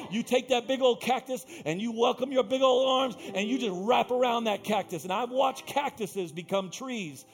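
loud, rising preacher's build; preaching at full force. Acted. Pastor urging the church to love anyway, preaching at full force. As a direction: preach it hard to the crowd. Preaching climax on loving others.

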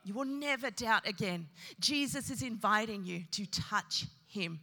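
normal talking voice

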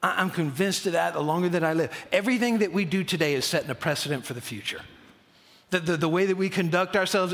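usual speaking voice

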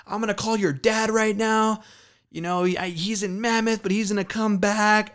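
high-pitched scolding mom impression; exasperated mom. Mom threatens to call dad.

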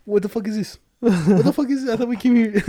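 put-on shocked the girl's man appeared; outraged disbelief. Retelling haunted house date, outraged disbelief. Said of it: "I was like".

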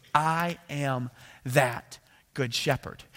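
very slow, deliberate proclamation; word by word, as a solemn claim; weighty declaration. Retold or imitated. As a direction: draw out each word for weight. Solemn climax of Jesus's declaration.

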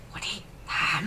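a hushed whispered nudge; เลียนเสียงกระซิบ. Retold or imitated. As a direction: whisper it like poking someone from behind. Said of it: "ไปสะกิดวูดดี้". (กระซิบเบาๆ เลียนแบบ)

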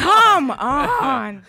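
loud, high drawn-out protest; retold cry of exasperation; exasperated outburst. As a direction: belt it out in mock exasperation. Retelling own exasperated reaction.

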